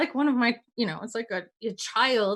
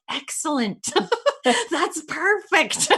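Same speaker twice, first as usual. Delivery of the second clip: delighted, laughing; 5 semitones higher, slower than usual. Retold or imitated. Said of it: "And I'm like".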